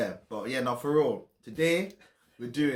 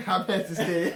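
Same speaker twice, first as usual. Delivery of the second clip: laughing intro; 6 semitones higher, 5 dB louder than usual. Acted. Intro catchphrase repeated.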